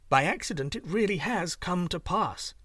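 ordinary delivery

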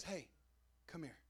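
soft, low and tender invitation; gentle call. Retold or imitated. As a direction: invite him kindly, almost hushed. Jesus calls gently.

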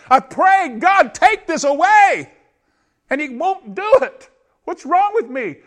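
high, loud cry of frustration, building; anguished pleading. Acted. Believer begging God over broken promises, anguished and rising. Acting out a despairing prayer.

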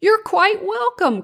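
high, lilting call in parting; cheery storybook call from a moth. A narrator's reading. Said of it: "called Connie as she fluttered on".

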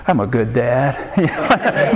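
very slow, drawn-out irony; mock self-reassurance. Shaken dad talking to himself after the near miss, mock self-praise. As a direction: stretch it out in comic disbelief.

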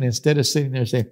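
normal talking voice

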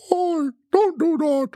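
unpracticed Kermit impression, way up high. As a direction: squeeze it up into a bad Kermit the Frog. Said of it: "that was my Kermit voice".

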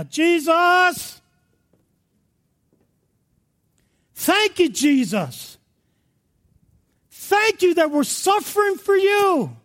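high, fervent cry to Jesus; crying out praise. Acted. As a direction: shout thanks up to heaven from a dungeon. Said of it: "I can hear it right now".

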